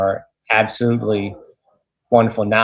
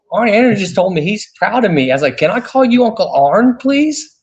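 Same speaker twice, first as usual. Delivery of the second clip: higher-pitched, giddy fanboy pleading; starstruck fan; 9 semitones higher than usual. Retold or imitated. Young wrestler asking his idol a favor, starstruck. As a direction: act out being thrilled, voice climbing to a high please. Retells being thrilled by idol's praise.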